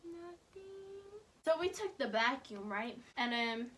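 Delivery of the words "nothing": very soft, breath-only denial; whispered denial. Retold or imitated. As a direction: whisper it so nobody finds out. Hiding the spill from stepdad.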